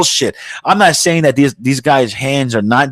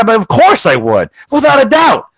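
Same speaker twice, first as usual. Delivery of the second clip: eager buddy; 11 semitones higher, 4 dB louder than usual. Retold or imitated. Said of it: "A guy".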